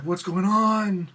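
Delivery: high, slow, drawn-out mock amazement; acted-out giddy disbelief; excited bewilderment. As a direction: stretch it out as if overwhelmed. Retelling his own bewildered excitement.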